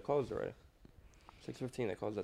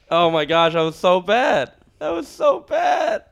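loud, higher cry of self-mockery; embarrassed outburst; 5 semitones higher, 5 dB louder, slower than usual. Real, not acted. Actor cringing at own failed accent attempt, embarrassed outburst. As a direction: exclaim it loudly, voice climbing and stretching out.

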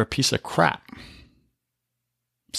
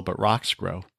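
"but rocks grow" repeated much softer, "rocks" still leaned on; quiet echo; 4 semitones lower, 6 dB quieter than usual. Shown as a demonstration. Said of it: "Nothing else grows in the soil".

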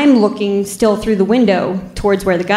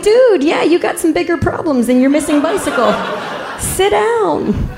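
high-pitched, exasperated comeback; incredulous retort; 8 semitones higher than usual. Retold or imitated. Officer to bleeding burglar asking for his bike, incredulous. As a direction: set him straight in a raised, disbelieving pitch. Officer tells dazed man off.

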